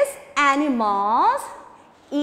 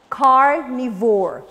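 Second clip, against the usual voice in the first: carnivore slow and loud; teaching new word; 3 semitones lower, 4 dB louder, slower than usual. Shown as a demonstration. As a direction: present carnivore slowly. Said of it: "or we can say".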